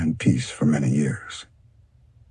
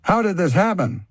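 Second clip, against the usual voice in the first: distraught. Acted. Panther finds elder frog dead.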